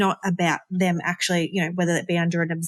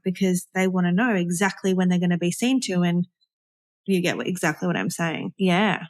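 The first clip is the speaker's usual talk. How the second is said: normal talking voice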